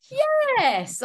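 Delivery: very high, loud, drawn-out yes; eager acceptance. Retold or imitated. Actor accepting an odd job offer, hammed-up eagerness. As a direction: jump at the offer, squealing with enthusiasm.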